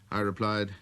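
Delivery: usual speaking voice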